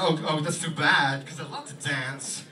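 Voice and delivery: slow, drawn-out Walken disappointment; Christopher Walken impression. Walken disappointed about dancing, slow and halting. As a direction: stretch it with odd pauses. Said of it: "he goes".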